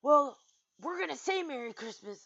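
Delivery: hammed-up indignant whine; freaking-out complainers. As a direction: imitate people in a huff. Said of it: "People are going around freaking out".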